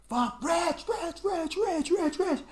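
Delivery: calling out. Retold or imitated. Brother frantically calling the name.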